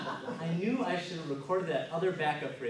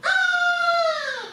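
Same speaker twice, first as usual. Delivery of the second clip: very high, loud, long scream; piercing sustained scream; 9 dB louder than usual. Acted. Android's imagined scream at the bear, screaming. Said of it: "This is what was happening in my head".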